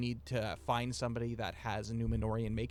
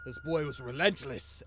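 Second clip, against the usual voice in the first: a lightly voiced grumpy dwarf just woken; barking a little at the intruders, mildly gruff; a hint of a cranky old dwarf; 3 semitones higher, 4 dB louder than usual. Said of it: "he goes".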